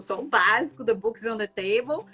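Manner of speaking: normal talking voice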